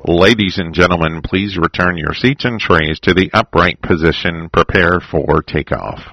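low, loud captain cabin announcement; pilot voice. Acted. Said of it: "You did that well".

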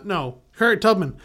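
ordinary talk

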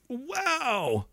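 soft, high, falling wow; stunned wow. Real, not acted. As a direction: breathe it out in quiet astonishment. Reacts to grim story he read.

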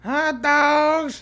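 high, loud stadium vendor yell. Retold or imitated. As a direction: belt it like a hot dog vendor. Imitating the vendor who yells.

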